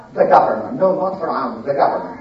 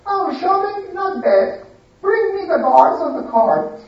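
high, pleased and bossy; spoiled lady; 9 semitones higher than usual. Acted. Lady agreeing to go shopping, high and bossy. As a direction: perk up, then give orders.

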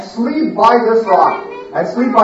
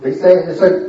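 ordinary delivery

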